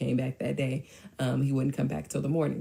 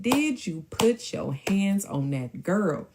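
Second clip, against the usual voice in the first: put-on slow, higher "Did you put your hands on that girl?", every word weighted; stern, drawn-out "hands on that girl"; emphatic repeated question; 5 semitones higher, slower than usual. Mom repeats the question after denial.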